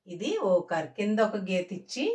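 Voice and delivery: ordinary talk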